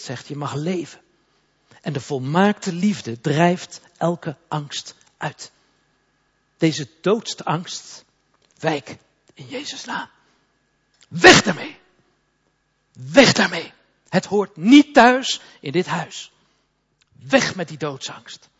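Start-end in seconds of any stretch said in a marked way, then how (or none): 1.8-10.1 slow, raised, intense proclamation against fear; preaching at full force. Acted. Preacher commanding fear to leave the congregation, slow and raised. 11.1-18.5 loud, higher shout casting fear out; shouted rebuke. Acted. Preacher shouting fear out of the house, full-force rebuke.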